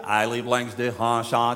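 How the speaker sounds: a breathy little run-up before "straat", slow and stretched; old Amsterdammer's "straat" with an extra lead-in; slow, stretched "straat" with a run-up in front. Shown as a demonstration. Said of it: "Echte oude Amsterdammers doen nog een aanloopje".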